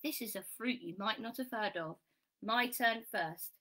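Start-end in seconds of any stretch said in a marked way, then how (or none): none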